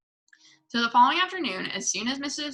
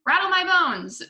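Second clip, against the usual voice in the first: high, loud ghostly parrot; a parrot imitating a spook, pitched way up; spooky parrot voice; 9 semitones higher, 6 dB louder than usual. A narrator's reading. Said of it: "imitation of a spooky voice".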